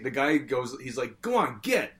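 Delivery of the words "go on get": high, curt shoo, as if chasing off a dog; mock shooing of a pest from the grill; shooing an animal. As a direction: flick him away like a stray. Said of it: "like shooing an animal".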